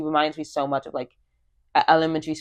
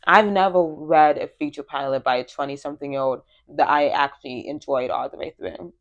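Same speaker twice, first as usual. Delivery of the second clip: ordinary talk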